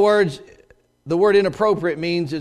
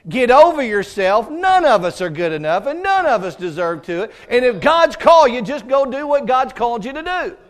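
louder, blunt pastor's rebuke; firm rebuke; 5 semitones higher, 6 dB louder than usual. Retold or imitated. Pastor telling the doubting young man to get over himself, firm and loud. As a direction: tell him off and push him to go. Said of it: "my preacher said".

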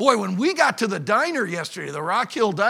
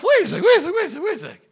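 high-pitched, repeated double take; acted startled objection, pitched way up; puzzled interruption; 9 semitones higher, 5 dB louder, much faster than usual. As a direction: halt yourself in a rising fluster. Acts his own puzzled interruption.

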